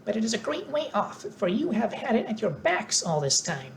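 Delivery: high, squeaky mouse queen. A narrator's reading. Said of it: "answered the Queen".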